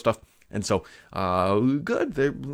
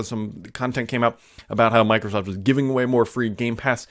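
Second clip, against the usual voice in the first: ordinary talk, nothing marked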